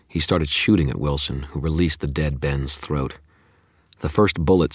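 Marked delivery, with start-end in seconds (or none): none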